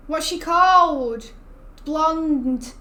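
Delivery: exasperated slow, loud "what's she called?"; frustrated straining. Real, not acted. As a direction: drag it out, grasping for a name. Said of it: "on the tip of my tongue".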